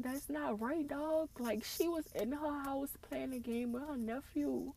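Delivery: high-pitched, tearful grief; heartbroken, high and close to crying; real tearful upset. Real, not acted. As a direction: plead through tears, pitch climbing. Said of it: "I started tearing up again".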